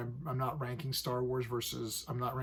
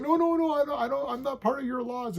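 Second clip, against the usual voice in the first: panicked pleading; 12 semitones higher, 5 dB louder than usual. Retold or imitated. Scared prisoner refusing the trial.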